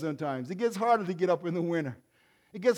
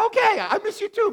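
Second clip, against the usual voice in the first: mock reply; 8 dB louder than usual. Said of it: "I say".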